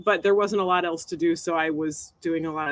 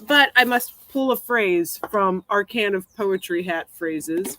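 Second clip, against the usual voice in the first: ordinary talk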